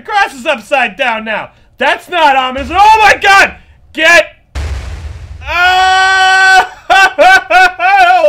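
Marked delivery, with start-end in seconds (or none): 2.7-8.3 real fright, high loud yelp into nervous laugh; jolted by a sudden scare, cry out then laugh it off; startled scream. Real, not acted. Scared by the game, he screams then laughs nervously.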